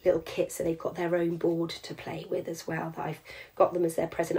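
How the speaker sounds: hushed secret whisper, then barely voiced. Real, not acted. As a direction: keep the present a secret, quietly. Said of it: "I don't know why I'm whispering that".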